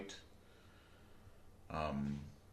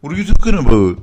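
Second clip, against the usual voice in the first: loud, exasperated shout; real shout; 5 semitones higher, 17 dB louder, faster than usual. Real, not acted. Pushing her friend for Canadian phrases, shouting. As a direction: yell it at your stalling friend.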